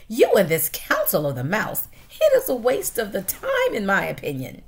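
mock his council; scornful wife.

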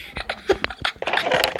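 breathy, unvoiced chuckle; real amused laughter. Real, not acted. Player laughing at the zero score, breathy laugh.

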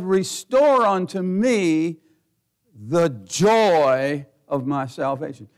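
drawn-out, solemn psalm quote; slow scripture reading. Acted. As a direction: read each word slowly like holy writ.

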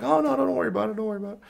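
high, sweet-voiced forgiving golfer; mock high polite stranger; mock golfer reply. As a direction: chirp it like an overly nice guy.

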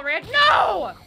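loud, high cry of dismay; real yell of alarm; alarmed shout. Real, not acted. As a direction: shout it in gamer panic. Panicked reaction in game.

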